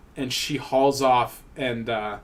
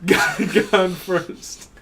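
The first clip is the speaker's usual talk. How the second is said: mock pious; 4 semitones higher, much slower than usual. Mocking pious phrase.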